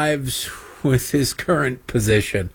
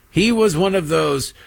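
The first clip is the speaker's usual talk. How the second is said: ordinary talk, nothing marked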